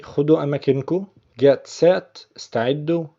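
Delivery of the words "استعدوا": normal talking voice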